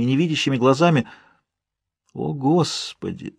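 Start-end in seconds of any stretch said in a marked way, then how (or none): 2.1-3.4 slow, soft, pained exclamation; an inward groan of anguish, hushed and drawn out; pained thought. A narrator's reading. Narrator watching his wife's distress, pained and hushed. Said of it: "с болью подумал я".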